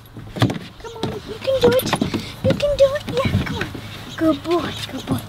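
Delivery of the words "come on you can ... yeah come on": high cheering encouragement; squeaky praising a dog. Real, not acted. Coaxing puppy to climb.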